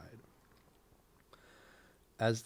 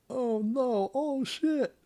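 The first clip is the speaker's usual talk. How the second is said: high, loud frightened shriek; panicked scream; 7 dB louder, much slower than usual. Acted. Victim sees sword raised.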